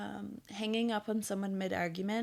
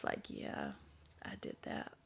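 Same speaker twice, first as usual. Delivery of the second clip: very soft, smirking self-satisfaction; smug; 12 dB quieter than usual. Acted. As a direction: quietly take the credit with a smirk. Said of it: "she's smirking".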